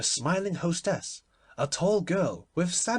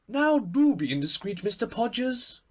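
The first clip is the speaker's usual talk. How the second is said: playful cry, higher and louder; a mock-urgent hostess cry; cried out; 10 semitones higher, 5 dB louder than usual. A narrator's reading. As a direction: call out teasingly to the palmist. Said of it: "cried Lady Windermere".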